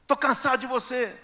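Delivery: high, loud mock wife, worn out; fed-up wife. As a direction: toss it back as the tired spouse. Wife voiced rejecting husband. (esposa cansada, voz aguda e forte)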